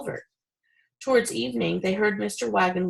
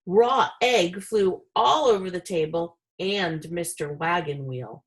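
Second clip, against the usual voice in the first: ordinary delivery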